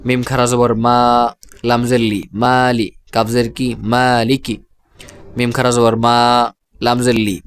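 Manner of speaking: long "aa" in মা and জা, short লি; spelled-out madd recitation. Shown as a demonstration. Teacher asks students to listen closely, measured spelling with মা, জা drawn out. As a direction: spell piece by piece, stretching the first vowel of মালিকি and জালিকা. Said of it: "আমি উচ্চারণ করছি".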